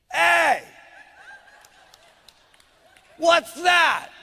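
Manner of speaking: loud, suspicious bark of a husband; a husband yells at his wife across the room; gruff husband shout. Retold or imitated. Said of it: "he goes".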